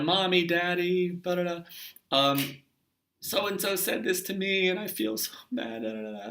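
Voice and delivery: put-on high, whimpering child voice; whiny upset child. Hurt child whining to mommy and daddy, high and upset. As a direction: imitate a hurt child crying to a parent.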